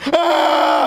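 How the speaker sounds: short high-pitched startled scream; sudden scream. Acted. Son startled by remembering Daddy's face, sudden high scream. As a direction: scream out abruptly. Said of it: "and I screamed".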